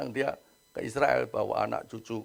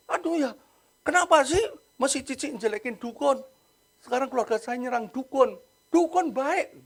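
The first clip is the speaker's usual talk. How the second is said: higher voice, lightly acted sister whining back; annoyed sister; 9 semitones higher than usual. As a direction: complain like the younger sister defending him. Sister complains about family.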